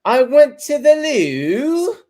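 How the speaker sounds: singsong British woman, high and drawn out; Jan. Retold or imitated. Mocking how Jan sings when talking, singsong British. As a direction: sing the words in a hammed-up British accent. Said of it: "she sings when she talks".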